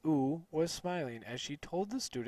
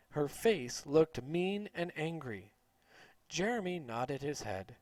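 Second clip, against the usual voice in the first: usual speaking voice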